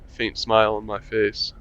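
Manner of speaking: ordinary talk, nothing marked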